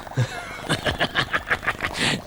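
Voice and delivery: voiceless evil snicker; villainous chuckle. Acted. Dougal delights in his diabolical plan, villainous snickering. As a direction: snicker away like a scheming scientist. Mad scientist before experiment.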